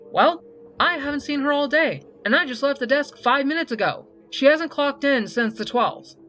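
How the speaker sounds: high, loud, insistent rebuttal; exasperated and emphatic; indignant retort. A narrator's reading. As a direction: argue back as a put-out head nurse. Nurse argues back.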